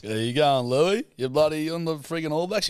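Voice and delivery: a jovial New Zealand dad on the phone; broad kiwi accent. Retold or imitated. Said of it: "Dad's from New Zealand".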